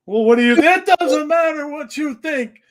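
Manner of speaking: high loud mock Rock promo; Rock impression. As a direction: bark it like The Rock cutting a promo. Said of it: "How he bait people into saying".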